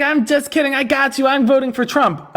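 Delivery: high-pitched mocking imitation; mocking the guest. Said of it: "He's like".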